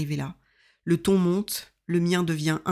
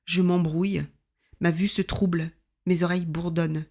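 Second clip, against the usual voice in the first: plain reading voice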